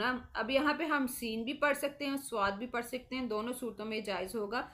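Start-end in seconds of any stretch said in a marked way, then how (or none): none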